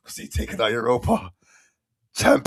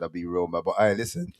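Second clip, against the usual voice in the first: ordinary talk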